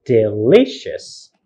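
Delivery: "Delicious" as de-LI-cious, drawn out; stress on second syllable. Shown as a demonstration. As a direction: slowly lean on LI in "Delicious". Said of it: "Second syllable".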